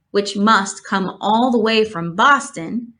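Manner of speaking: ordinary delivery, nothing marked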